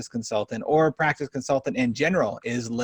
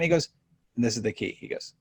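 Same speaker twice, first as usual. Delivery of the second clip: ordinary talk, nothing marked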